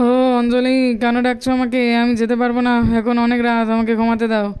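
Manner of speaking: a lazy husband who just wants to sleep; sleepy father. Acted. Sleepy father refuses to wake.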